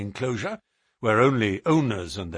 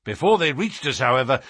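plain reading voice

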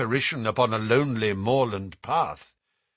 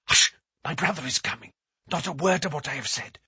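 hurried hush; faster than usual. Acted. Warning before brother arrives.

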